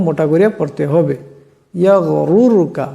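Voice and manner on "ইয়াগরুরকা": ইয়াগরুরকা a little slowly, both র heavy. Shown as a demonstration. Teacher closing the example with ইয়াগরুরকা and its heavy র. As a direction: say ইয়াগরুরকা with thick র sounds. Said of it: "এমন করে".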